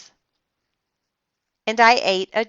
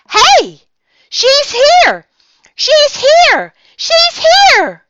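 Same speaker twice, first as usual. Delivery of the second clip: very high, loud, rising thrilled squeal; squealing thrilled little girl; thrilled yell; 13 dB louder, slower than usual. A narrator's reading. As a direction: yell it, beside yourself with joy. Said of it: "I yelled, real thrilled".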